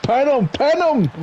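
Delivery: high, excited yelps; urgent calling out. Real, not acted. Co-commentator urging Luke to pin him, urgent high call. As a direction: urge him on, pitch jumping up.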